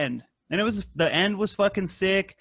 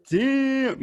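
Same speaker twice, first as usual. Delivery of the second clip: surprised; 10 semitones higher than usual. Retold or imitated. Shocked at the movie.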